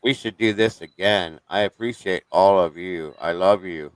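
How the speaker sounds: low, slow, lifeless monotone drone; monotone impression. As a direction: drone it out like a boring streamer with no personality. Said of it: "that's how he sounds".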